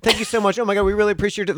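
high, gushing thank you; effusive thanks. Retold or imitated. Grateful volunteers at table gushing thanks. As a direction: gush thanks over and over. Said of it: "the thank yous that would never end".

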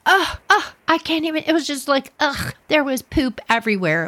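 groaning ugh, pitch jumping up; real disgust breaking through the story. Real, not acted. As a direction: recoil at the memory with pained ughs. Disgust at melted snow poop.

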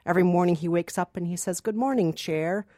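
ordinary talk, nothing marked

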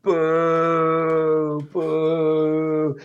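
lightly voiced crowd booing; a hint of a booing crowd; mock crowd booing. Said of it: "everyone was like".

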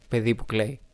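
normal talking voice

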